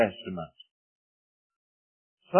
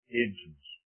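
normal talking voice

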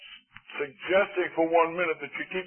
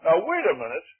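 ordinary talk, nothing marked

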